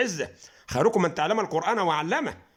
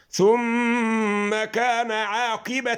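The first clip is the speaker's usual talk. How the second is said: slow melodic tajweed chant, long held vowels; slow tajweed recitation of the verse; Quran recitation; much slower than usual. Shown as a demonstration. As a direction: recite the verse slowly, chanting each word out. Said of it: "تقول إيه؟".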